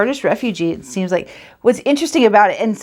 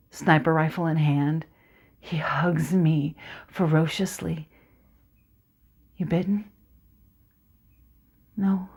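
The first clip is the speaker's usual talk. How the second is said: soft, tense recital; dramatic monologue narration; 2 semitones lower, 7 dB quieter than usual. Acted. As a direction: draw the listener into the dark orchard.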